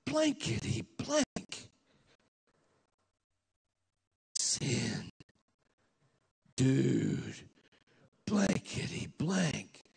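lightly voiced slurring stoner, slowed down; slow, woozy stand-in swearing; mock drunk cursing. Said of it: "I can't say it in church, so I'll say it this way".

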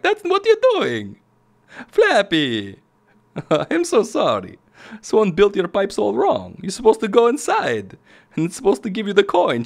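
put-on high-pitched, hammy Italian plumber accent; exaggerated cartoon Italian accent; terrible Mario accent. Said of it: "That's a terrible Mario accent".

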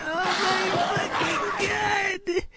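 very high shouted disbelief; shocked shout. Real, not acted. Fan watching the battle turn, high stunned shout. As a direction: let it burst out, stunned.

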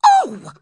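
loud high scream of pain; sudden "Oh!" shrieked for show. A narrator's reading. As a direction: cry out sharply as if hurt. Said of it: "I screamed".

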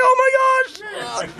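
high, drawn-out mock shriek; shocked squeal. Preacher reacting to a kiss on the cheek, mock shocked squeal. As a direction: act out stunned surprise way up high, slowly.

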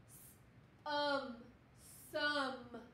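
"S-" and "um" split, then blended into "some"; sounding out. Shown as a demonstration. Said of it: "can you sound it out with me?".

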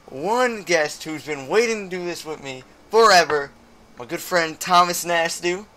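ordinary talk

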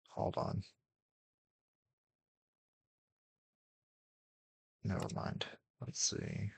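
hushed whisper to self. Real, not acted. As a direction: whisper it under your breath. Sudden whispered asides.

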